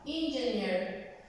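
"engineer" said slowly and clearly; slow English demo. Shown as a demonstration. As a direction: model "engineer" slowly for the class. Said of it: "Lo voy a pronunciar cuatro veces".

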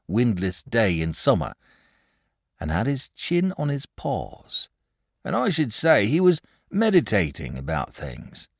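a shepherd spinning a spooky tale by the fire; old shepherd. Acted. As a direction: tell it like a wary old country shepherd. Shepherd voiced in read story.

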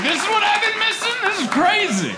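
very high, amazed squeal; mock shock at debates; stunned. First-time voter shocked watching debates, very high squeal. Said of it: "I was like".

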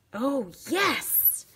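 thrilled outburst; delighted triumph. Real, not acted. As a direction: exclaim it like a small victory. Delighted that the piece works.

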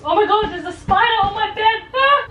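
startled high shriek at a spider; sudden scream, spider on the bed. Real, not acted.

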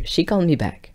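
ordinary talk

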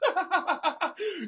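high cackling laugh. Real, not acted. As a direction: burst out laughing at your own joke. Laughing at own insult.

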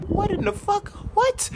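very high, squeaky disbelief; shocked squeal. Real, not acted. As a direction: shriek it in shock.

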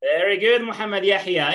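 ordinary talk, nothing marked

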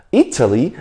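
"Italy" with its first syllable stressed. Shown as a demonstration. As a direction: hit the opening I of "Italy". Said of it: "重音在哪里".

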